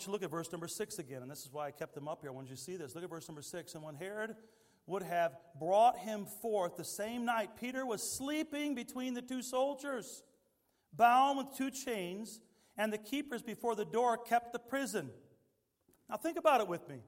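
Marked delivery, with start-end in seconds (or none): 3.7-15.1 slow, louder scripture read. Acted. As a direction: read the verse with weight. Reads scripture verse aloud.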